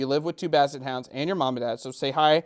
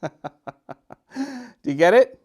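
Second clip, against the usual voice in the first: ordinary delivery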